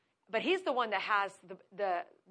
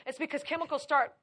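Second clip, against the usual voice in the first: usual speaking voice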